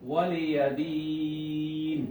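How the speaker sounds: very drawn out, chanted stop; slow recitation. Shown as a demonstration. Teacher shows the pause reading to students, very slow. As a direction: stretch each syllable slowly like Quran recitation. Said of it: "ওয়াকফ অবস্থায়".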